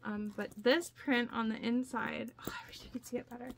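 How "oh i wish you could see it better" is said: soft unvoiced whisper, the second line quicker; breathy aside to the camera. Real, not acted. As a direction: hush it as if sharing a secret. Showing the print, talking softly.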